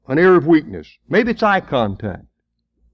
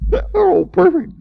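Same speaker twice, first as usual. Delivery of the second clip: mock. Sarcastic about perfect sweethearts.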